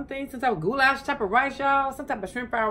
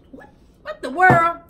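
incredulous; 5 semitones higher, 7 dB louder than usual. Real, not acted. Disbelief at husband.

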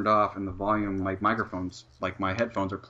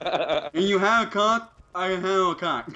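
deaf voice impression; 8 semitones higher, 6 dB louder than usual. S1 outdoes S0 by voicing the club girl, hammed-up deaf voice. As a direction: ham up a deaf woman's strained speech. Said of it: "I can do the impression for you".

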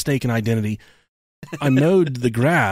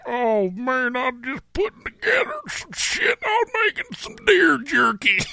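much higher, strained put-on brother voice; big brother voice; 12 semitones higher than usual. Brother explaining the deer jerky to him, high put-on voice. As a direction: play the outdoorsy brother, squeaky and cocky. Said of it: "He's like".

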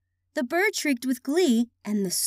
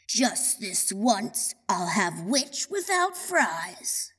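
low, slow, quieter dragon voice; menacing dragon; 5 semitones lower, 4 dB quieter, slower than usual. A narrator's reading. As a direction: savour it slowly as a hungry dragon. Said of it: "The dragon drew near with a glint".

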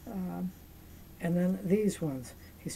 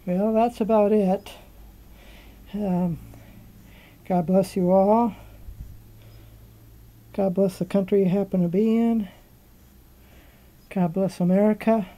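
loud sign-off; 9 dB louder than usual. Real, not acted. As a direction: call it out to everyone.

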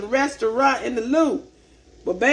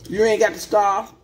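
ordinary talk, nothing marked

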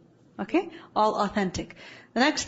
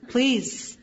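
usual speaking voice

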